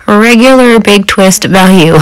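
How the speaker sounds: ordinary talk, nothing marked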